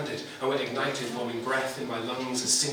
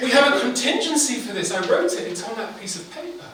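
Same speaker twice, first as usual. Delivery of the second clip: high, urgent intern voice; crying intern; 9 semitones higher, 4 dB louder than usual. A narrator's reading. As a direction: stammer in, pitched up and frantic. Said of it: "cried an intern".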